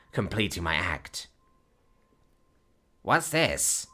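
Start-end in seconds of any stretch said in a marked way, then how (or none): 3.0-3.9 gnome. A narrator's reading. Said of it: "the gnome asked".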